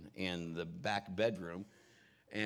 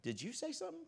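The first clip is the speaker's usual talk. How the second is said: puzzled question; 7 dB quieter, faster than usual. Retold or imitated. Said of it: "I said".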